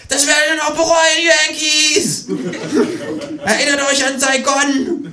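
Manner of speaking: very high kangaroo shriek; high-pitched parting yell; screamed threat. A narrator's reading. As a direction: scream defiance while being thrown out. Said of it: "durch die Tür und schreit".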